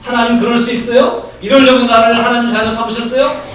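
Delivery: hammed-up indignant complaint; mock accusing prayer. Pastor acts out a sulking believer's prayer, mock indignant. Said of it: "하나님께 이런 기도하면".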